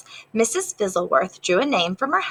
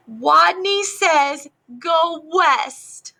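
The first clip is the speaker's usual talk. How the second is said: strong call; 8 semitones higher, 3 dB louder, much slower than usual. Acted. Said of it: "In a voice so strong".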